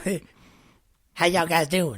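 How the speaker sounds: a hammed-up Southern drawl impression; mock Southern accent. Imitating absent cohost Ryan's terrible accent, mock Southern. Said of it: "he would do an accent".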